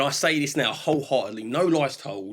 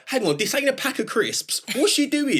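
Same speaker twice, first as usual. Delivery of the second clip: pitched-up mock astonishment; incredulous disbelief; 11 semitones higher than usual. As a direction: replay your shock at what she's eating. Retelling shock at mice tails.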